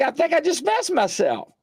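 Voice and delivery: high-pitched comic panic; hammed-up high alarm; retold mock fright. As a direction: act out being scared silly, pitched high. Retelling own fright.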